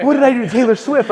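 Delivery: high, loud bewildered panic; freaking out. Retold or imitated. Founder recalling his bewilderment at being sued by [name], high and panicked. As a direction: plead in retold disbelief. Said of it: "I'm freaking out".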